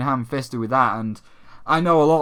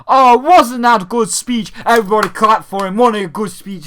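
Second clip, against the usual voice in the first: loud, gushing fake host voice; mock fawning host; 5 semitones higher, 7 dB louder than usual. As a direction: ham up a fawning presenter. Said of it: "being like".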